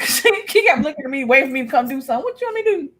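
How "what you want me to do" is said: mock helpless reply. Acts out her reply to crying child.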